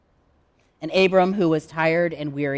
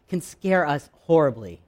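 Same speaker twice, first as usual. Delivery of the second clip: ordinary talk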